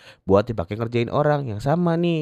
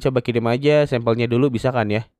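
usual speaking voice

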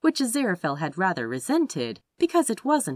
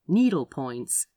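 usual speaking voice

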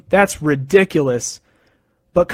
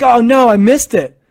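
dismayed cry; 5 semitones higher, 5 dB louder than usual. Retold or imitated. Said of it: "And I'm like".

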